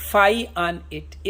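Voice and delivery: slow, drawn-out reading of Shakespeare; slow recitation. Shown as a demonstration. Teacher reading Hamlet's soliloquy to students, slow solemn recitation. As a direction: recite each word slowly and solemnly.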